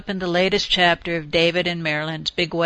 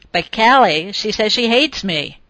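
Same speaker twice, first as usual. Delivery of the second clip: mild wail; 3 semitones higher, 5 dB louder than usual. Acted. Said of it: "he wailed".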